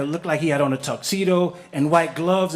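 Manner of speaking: ordinary talk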